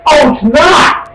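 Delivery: high, drawn-out mock surprise; sarcastic mock surprise. Real, not acted. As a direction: feign shock, dripping sarcasm.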